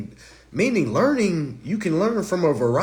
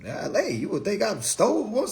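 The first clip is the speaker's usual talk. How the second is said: ordinary talk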